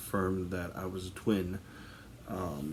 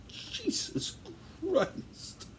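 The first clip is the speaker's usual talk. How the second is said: stifled laugh; 4 dB quieter, much slower than usual. Real, not acted. Stifled laugh while crying.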